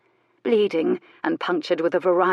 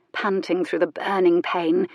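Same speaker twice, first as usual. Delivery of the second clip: usual speaking voice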